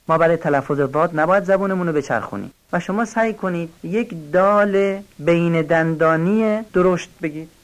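ordinary talk

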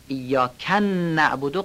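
نعبدو with its n doubled, "iyyakannabodo"; extra wrong shadda. Shown as a demonstration. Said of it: "نون نعبدو و نون نستعین تشدید نداره".

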